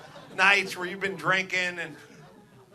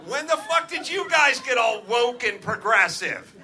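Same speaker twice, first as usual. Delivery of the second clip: higher and louder, a hammed-up tirade with laughs; mock outraged rant; 6 semitones higher, 4 dB louder than usual. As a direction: scold a whole state in jest, building to a shout.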